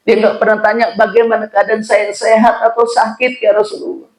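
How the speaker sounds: put-on old father tearfully pouring out his hurt to the Prophet. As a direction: plead with rising grief, voice climbing. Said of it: "sambil sedikit meneteskan air mata".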